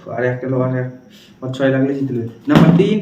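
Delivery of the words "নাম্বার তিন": excited call. Real, not acted. Calling for a lucky roll.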